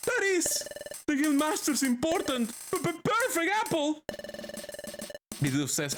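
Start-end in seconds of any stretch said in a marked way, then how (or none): 0.1-4.0 Chatot voice. Acted. Chatot panicking about apple.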